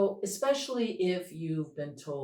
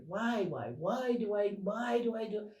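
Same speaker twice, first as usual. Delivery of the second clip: play-acted exasperation at yourself; mock dismay; 6 semitones higher than usual. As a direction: climb in pitch as you lament. Acting out frustrated self-questioning.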